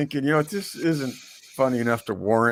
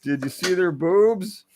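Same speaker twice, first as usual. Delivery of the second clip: put-on kid voice; 8 semitones higher than usual. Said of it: "the kid looks up and goes".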